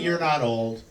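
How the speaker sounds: ordinary talk, nothing marked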